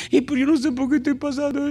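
a hammed-up tearful whine; mock crying whine. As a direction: play a self-pitying believer on the verge of tears. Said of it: "pues deja de llorar".

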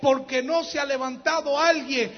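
rising to a shouted sermon climax; fiery preaching. Acted.

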